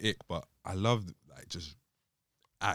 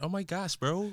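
mock American girl, pitched high; high-pitched imitation of an American girl; American girl accent; 10 semitones higher than usual. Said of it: "When girls speak American".

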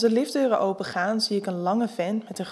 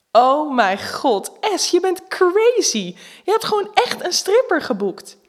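shocked excitement; 10 semitones higher, 8 dB louder, slower than usual. Acted. Friends shocked by the stripper.

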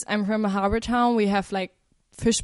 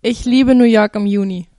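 loud demo phrase; 10 dB louder than usual. Shown as a demonstration. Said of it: "you all speak it".

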